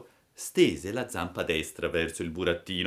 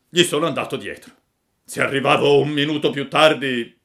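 loud, raised big-dog voice; kindly rescuer dog; 7 semitones higher, 9 dB louder than usual. A narrator's reading. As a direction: speak as a hearty dog to a friend. (cane generoso, voce forte)

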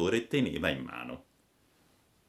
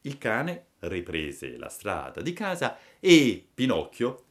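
ordinary delivery, nothing marked

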